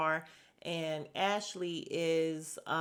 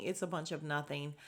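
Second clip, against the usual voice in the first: normal talking voice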